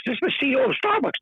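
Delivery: high, fast, indignant jab; indignant retort. Retold or imitated. Guest snapping back at the Starbucks CEO, high and fast. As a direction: fire it back at him quickly. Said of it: "So I say to Howard Schultz".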